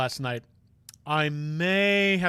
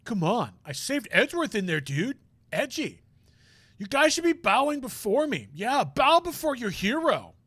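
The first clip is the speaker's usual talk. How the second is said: cocky show-off; 6 semitones higher than usual. Acted. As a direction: brag to friends about your big moment. Voices boastful friend character.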